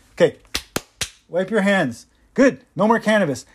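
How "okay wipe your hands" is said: usual speaking voice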